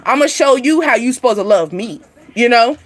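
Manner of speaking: ordinary talk, nothing marked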